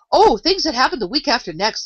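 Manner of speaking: careless. A narrator's reading. The Queen replies to Alice in a careless tone. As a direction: toss it off carelessly, high and loud. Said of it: "replied in a careless tone".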